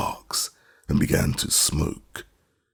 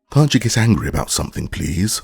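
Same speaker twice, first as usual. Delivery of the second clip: eager fast plea; 5 semitones higher, 4 dB louder, faster than usual. A narrator's reading. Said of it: "asked Ojo".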